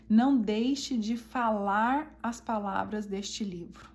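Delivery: slow, measured quoting of scripture; emphatic scripture quote. Acted. Quoting the Bible to listeners as an instruction, slow and emphatic. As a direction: spell out each phrase slowly and deliberately.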